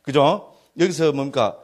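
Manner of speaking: normal talking voice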